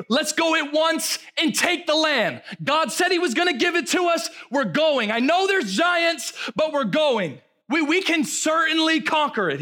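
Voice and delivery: rally them to take the land; Caleb's rallying shout. Retold or imitated. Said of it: "Caleb tried to quiet the people".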